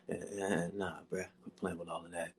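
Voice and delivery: Jerome voice. Acted. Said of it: "you sound like Jerome from the Morton Show".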